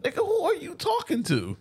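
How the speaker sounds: very high, incredulous comeback; mock indignation. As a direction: talk back to the villain in comic disbelief. Acted indignant reply to villain.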